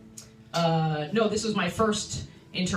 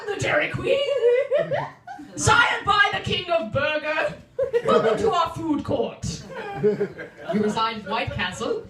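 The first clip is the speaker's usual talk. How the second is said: higher, louder, slow royal announcement with laughter; hammed-up Dairy Queen royalty; regal queen proclamation; 9 semitones higher, 4 dB louder than usual. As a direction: proclaim like a British monarch. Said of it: "Is she like".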